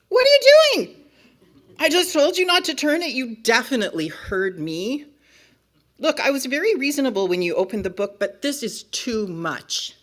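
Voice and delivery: loud, high outburst, then fast scolding; exasperated book character. Acted. Book character to reader who turned the page, exasperated. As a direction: explode at the disobedient reader, then rein it in.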